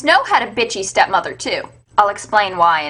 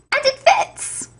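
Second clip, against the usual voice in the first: and it fits, squeaked way up high; a sarcastic, cheery cry of triumph; mock excited squeal; slower than usual. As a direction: act thrilled at the absurd fit. Second stepsister's foot also fits.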